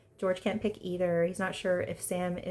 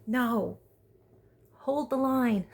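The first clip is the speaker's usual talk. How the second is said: higher, louder, slow emphatic cheering on; rally your friend like a hype girl; hyped girlfriend pep talk; 5 semitones higher, 4 dB louder, slower than usual. Retold or imitated. Vlogger urging Robin not to feel bad, loud encouragement. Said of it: "And it's like".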